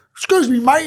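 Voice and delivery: high, loud mock politeness; imitating a workmate. Workmate at a stranger's door, imitated high loud voice. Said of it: "this is how he talks".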